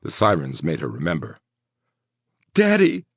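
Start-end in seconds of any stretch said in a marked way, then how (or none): 2.5-3.0 high, loud desperate cry for her father; cried out. Acted. Woman remembering her hurt father, crying out loud. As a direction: she cries out, remembering her dad. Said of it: "she cried".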